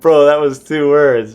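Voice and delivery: high, loud, slow mock complaint; loud, drawn-out mock protest; retold callout. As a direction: stretch it out in exasperated reproach. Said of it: "And then I say".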